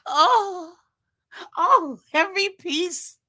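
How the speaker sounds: high, loud swoon over food; acted foodie ecstasy. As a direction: moan with mock rapture at each bite. Re-enacts eating beloved shrimp.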